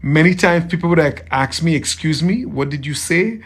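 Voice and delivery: normal talking voice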